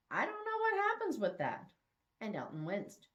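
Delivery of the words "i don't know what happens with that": high, bewildered lilt; Bethany confessing she doesn't know, high and unsure; unsure young woman. Acted. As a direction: let her voice float up, lost.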